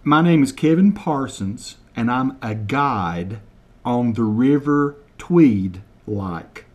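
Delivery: slow, deliberate over-enunciation of each word; slow mock translation. Shown as a demonstration. Translating Kevin's Scottish for viewers, slow and deliberate. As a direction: spell it out slowly for Americans, deadpan. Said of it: "I'm gonna translate word for word".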